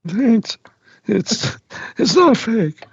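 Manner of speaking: put-on high old man voice kept going. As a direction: stay in the old-timer voice. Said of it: "This is what I really sound like".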